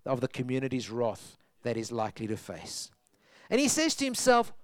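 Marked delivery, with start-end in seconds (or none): none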